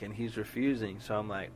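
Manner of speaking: normal talking voice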